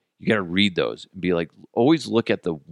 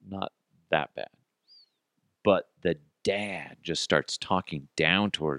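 usual speaking voice

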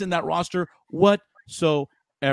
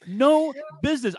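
exasperated; 11 semitones higher than usual. Real, not acted. Repeating his rant point.

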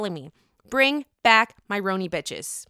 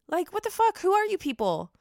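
incredulous; 10 semitones higher than usual. Real, not acted. Outraged at reality stars.

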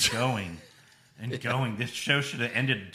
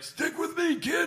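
high, squeaky mock Laurinaitis voice; Laurinaitis impression. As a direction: squeak it out like a goofy manager, laughing. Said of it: "Laurinaitis was like".

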